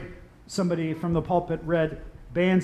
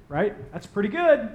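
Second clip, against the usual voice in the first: ordinary delivery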